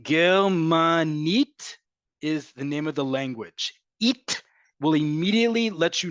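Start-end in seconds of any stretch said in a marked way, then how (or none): 0.0-1.8 Germanit very slow, ending on a rising NIT; stress on Germanit's last syllable. Shown as a demonstration. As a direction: draw out Germanit and land firmly on NIT. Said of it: "indicate where the emphasis".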